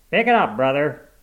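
loud, impatient cop's snap; snapped order. A narrator's reading. Cop hurrying a driver at the light, snapping. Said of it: "snapped a cop".

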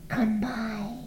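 high, soft goblin call. A narrator's reading. Goblins calling to the maids, high sly call. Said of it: "called the goblins".

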